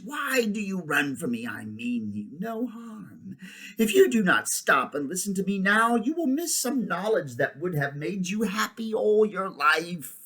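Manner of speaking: high, theatrical witch voice; imperious witch, coaxing. A narrator's reading. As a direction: coax the boy with haughty persuasion.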